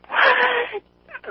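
very high, loud bleeped-sounding outburst; a sudden high burst in place of a swear; outraged yelp. Retold or imitated. Retelling reaction to the Brad Pitt remark, outraged yelp. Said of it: "I clenched my jaw".

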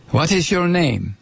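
mock German interrogator; movie German accent. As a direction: question him in a film German accent. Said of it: "would consider a German accent".

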